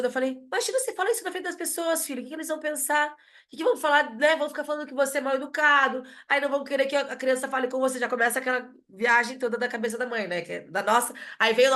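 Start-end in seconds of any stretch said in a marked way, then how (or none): 0.3-8.0 high, anxious mother piling on fears; a fretting mother spinning worst cases, pitched up; worried mom lecturing. Retold or imitated. Mother warns her son what people will think, high and anxious.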